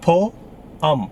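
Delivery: poem split into "Po-am"; syllable by syllable. Shown as a demonstration. Teacher showing learners the correct word, syllable by syllable. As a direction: say "Po-am" in two separated beats. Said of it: "The correct pronunciation is".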